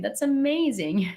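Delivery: ordinary talk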